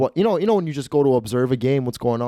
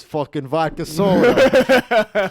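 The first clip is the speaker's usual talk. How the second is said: loud, high and drawn out; mock outburst; 6 semitones higher, 6 dB louder, much slower than usual. Gambler corrected about the drink, hollering back slowly. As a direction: stretch it out in a big mock holler.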